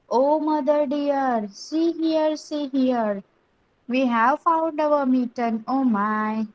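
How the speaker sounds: slow, measured nursery-rhyme reading; poem recitation. Acted. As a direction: recite it phrase by phrase for young pupils. Reads the poem aloud to class. (मुलांसाठी सावकाश कविता वाचन)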